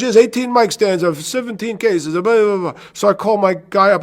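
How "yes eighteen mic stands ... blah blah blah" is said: custodian. Retold or imitated.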